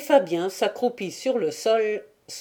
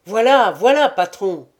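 loud, quick answering call; a hearty reply called across; calling back; 7 dB louder than usual. A narrator's reading. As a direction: shout back to the boss from afar. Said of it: "répondit aussitôt".

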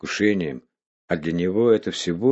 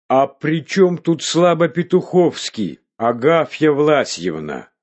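slow, high indignant protest; outraged and stung, drawn out; 8 semitones higher, 5 dB louder than usual. A narrator's reading. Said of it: "пятнами покрылся от возмущения".

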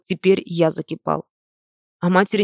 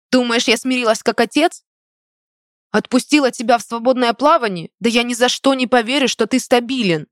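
hissing; 5 semitones higher, 4 dB louder than usual. A narrator's reading. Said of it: "Прошипела".

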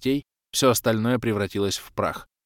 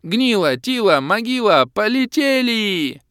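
loud high odd elf cry, drawn out at the end; summoning call; 13 semitones higher, 8 dB louder, slower than usual. Acted. Elf summoning his ghosts, loud call. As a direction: send the ghosts flying.

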